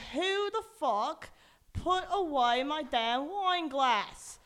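put-on slow, drawn-out grandmother's accent; grandmother impression. Imitating grandmother demanding the wine glass, slow accented grandmother voice. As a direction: stretch the words out like a cross grandmother. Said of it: "That's more like my grandmother".

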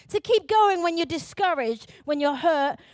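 loud, building preacher's cry; preaching at full force. Acted. Rising exhortation to congregation.

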